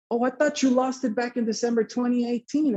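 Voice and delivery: high-pitched imitation of a confused doctor; surprised realization. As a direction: retell someone caught out by a mix-up. Doctor voiced realizing his error.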